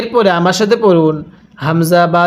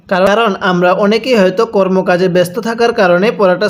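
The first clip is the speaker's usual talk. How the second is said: ordinary delivery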